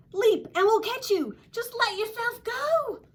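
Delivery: high, loud urging; crowd calling out. A narrator's reading. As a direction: call up encouragingly to someone in a tree. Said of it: "the crowd called".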